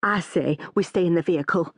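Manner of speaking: a touch of edginess; understated nervy insistence; edgy. A narrator's reading. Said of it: "sounding edgy".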